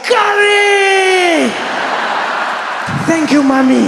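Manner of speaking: put-on high, loud ecstatic childish cry; joyful shout. A boy thanking his mom for curry, in a high joyful shout. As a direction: shout it out in pure delight. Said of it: "I was like".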